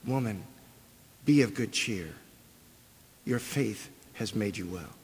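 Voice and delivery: soft, gentle words of comfort; Jesus's gentle words to the frightened woman; tender. Acted. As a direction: speak it gently to comfort her.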